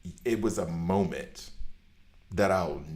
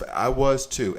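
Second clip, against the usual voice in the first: higher and louder, excited; higher, louder excited confession; excited admission; 6 semitones higher, 7 dB louder, much slower than usual. Real, not acted. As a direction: blurt it out gleefully. Admitting he screamed too.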